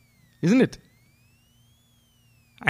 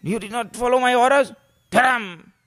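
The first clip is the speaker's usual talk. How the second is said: booming divine rebuke, louder and higher; angry Lord; 9 semitones higher, 7 dB louder than usual. Pretend. The Lord scolding a disobedient devotee, angry booming. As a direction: thunder down like an offended god. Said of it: "Lord gets angry".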